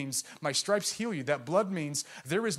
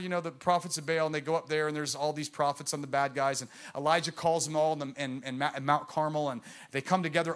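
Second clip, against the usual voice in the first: ordinary delivery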